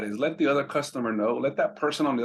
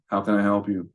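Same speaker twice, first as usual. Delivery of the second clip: flat, lower, joyless "How can I help you?"; hint of a bored Walmart cashier; bored, miserable clerk; 4 semitones lower than usual. Shown as a demonstration. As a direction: sound like you hate your job. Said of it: "someone at Walmart that hates their life".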